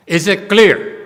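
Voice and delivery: stern teacher's loud check; forceful demand. Real, not acted. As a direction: demand an answer from the hall. Demanding the audience confirm.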